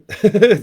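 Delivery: short high laugh burst; bright laugh. Real, not acted. Laughs at own quip.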